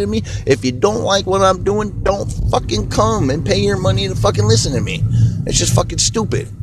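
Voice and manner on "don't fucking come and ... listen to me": ordinary talk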